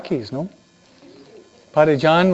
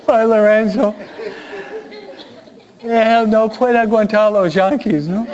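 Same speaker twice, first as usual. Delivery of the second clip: amused chuckling words; laughing; 6 semitones higher than usual. Real, not acted. Priest laughing about Padre Lorenzo hating the Yankees, laughing voice.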